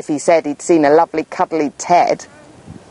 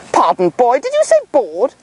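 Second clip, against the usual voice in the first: higher, outraged question; indignant teacher; 4 semitones higher than usual. Acted. Teacher questioning the boy, indignant. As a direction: demand it in disbelief. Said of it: "asked the teacher".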